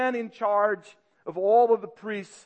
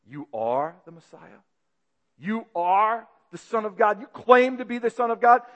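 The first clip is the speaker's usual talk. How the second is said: stunned then indignant; disbelief building. Acted. Caiaphas's growing disbelief.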